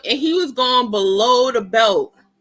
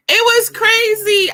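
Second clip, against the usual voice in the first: amazed; 9 semitones higher, 4 dB louder, slower than usual. Real, not acted. Reacting to the debate.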